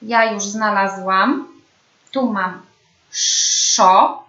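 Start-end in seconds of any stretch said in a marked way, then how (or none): 3.1-4.2 "Szo" with a long hushing sz before the vowel; drawn-out SZ. Shown as a demonstration. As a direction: model the syllable "Szo", sz held long. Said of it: "Powtarzamy sylabę".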